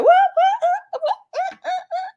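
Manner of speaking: high laughing whats, imitating heated Mel; heated disbelief. Mel retold, squeaking high disbelief with a laugh. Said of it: "Mel's getting heated right there".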